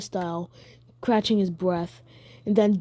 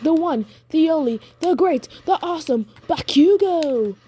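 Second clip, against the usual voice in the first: boastful Bakugo; 7 semitones higher, 3 dB louder than usual. Acted. Storyteller playing Bakugo declaring himself the great one, boastful. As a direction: crow your own name like a champion.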